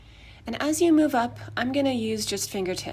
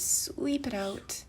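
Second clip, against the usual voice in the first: slow, drawn-out sweep it out, a bit higher; 4 semitones higher, much slower than usual. Shown as a demonstration. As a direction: stretch out sweep it out slowly. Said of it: "it could be nice to sweep it out".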